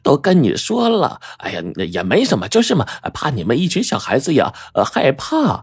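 high, indignant landlady, then backing off; flustered landlady. A narrator's reading. Landlady miffed at the doubting girl, flustered high protest. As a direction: protest with jumps up into high pitch. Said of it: "老板娘一听这话急了".

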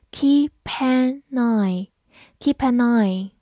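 "keep an eye" linked smoothly, slow; linked reading. Shown as a demonstration. Teacher demonstrating linking for students. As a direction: glide "keep an eye" together slowly. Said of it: "三个词有一个连读".

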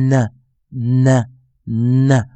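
"呢" as a held N released into a schwa; isolated, drawn-out N sound on "呢"; stressed dark N. Shown as a demonstration. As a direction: model "呢" as a heavy "nnn-uh". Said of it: "再注意听一遍".

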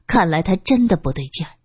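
plain reading voice

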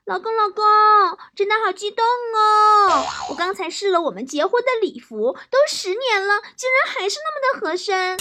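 high-pitched gushing wife; very excited. Acted. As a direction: squeal the news to your husband. (夸张地尖声激动报喜)

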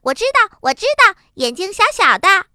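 high, loud know-it-all chirp; blurting little rabbit. A narrator's reading. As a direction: shout it back while running off. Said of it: "一边跑还一边说". (边跑边高声嚷嚷)

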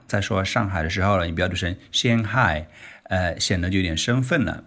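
ordinary talk, nothing marked

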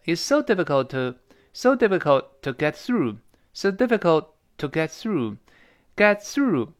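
"so difficult to get through" slowly, t unreleased; slow demo. Shown as a demonstration. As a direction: spell it out slowly for students. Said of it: "slow demo of sentence".

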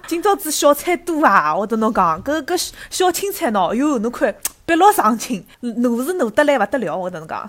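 loud, high Shanghainese stall auntie selling hard; market auntie voice. Pretend. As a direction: sell your greens like a chatty vegetable auntie. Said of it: "我需要一个助手来假装阿姨".

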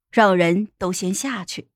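ordinary delivery, nothing marked

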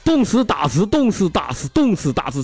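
Xiaoming answering teacher proudly; eager schoolboy. Acted. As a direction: shout the answer like a show-off kid. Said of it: "小明啪就站起来就说".

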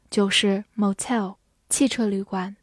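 usual speaking voice

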